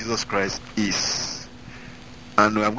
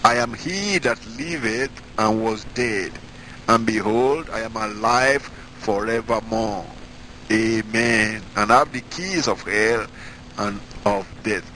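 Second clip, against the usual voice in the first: raised, slow solemn reading of scripture; slower than usual. Acted. Preacher to congregation, reading Revelation in solemn raised voice. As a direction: read the verses out like holy proclamation.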